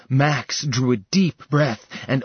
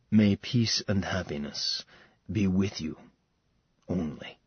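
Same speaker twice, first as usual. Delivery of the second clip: a quiet, tender farewell blessing, fading low; soft blessing; 3 semitones lower, 5 dB quieter than usual. Acted. Falcon blessing Seraphina as he withdraws, softly tender. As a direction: bless her gently as you leave.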